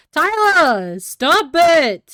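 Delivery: loud exasperated yell; shouting. Real, not acted. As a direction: yell it, half laughing. Shouting at friend to stop.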